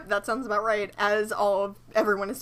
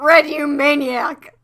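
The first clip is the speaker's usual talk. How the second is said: slow, loud, higher yell; mock outburst; 4 semitones higher, 9 dB louder, slower than usual. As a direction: call him out dramatically. Reads her note as an outburst.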